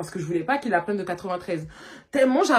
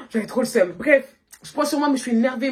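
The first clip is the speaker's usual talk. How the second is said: usual speaking voice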